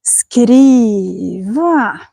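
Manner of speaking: "skriva" drawn out slowly, pitch falling, rising, then falling again; word accent demo. Shown as a demonstration. Teacher showing viewers the word melody, slow demo. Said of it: "Så här, skriva".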